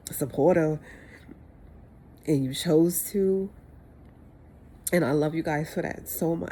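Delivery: ordinary talk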